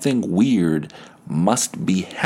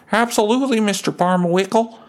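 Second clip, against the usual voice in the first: put-on very high, keen young helper; chirpy yes-sir boy; bright eager kid answering his neighbour; eager paper boy; 13 semitones higher, faster than usual.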